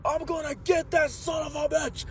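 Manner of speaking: high mock shout of an angry dad; hammed-up enraged father; dad charging in, high and shouted; furious dad. Dad runs over furious.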